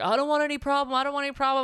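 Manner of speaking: high-pitched imitation of a waiter; defensive waiter. Waiter answering an upset customer, defensive. Waiter backing off, voiced in story.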